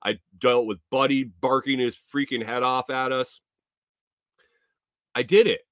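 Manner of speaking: usual speaking voice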